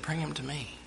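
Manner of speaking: quietly firm, in charge; a lightly voiced calm Jesus; calm command. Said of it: "And Jesus said".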